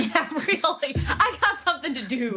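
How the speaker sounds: amused, laughing agreement. Real, not acted. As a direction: play along with the joke while laughing. Laughs along with the joke.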